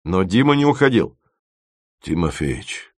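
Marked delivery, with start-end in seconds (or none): 2.0-2.9 soft, low, shy address; bashful. A narrator's reading. Dima lingers to confide in his friend, bashful. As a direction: bring the name out shyly. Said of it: "вымолвил он стыдливо".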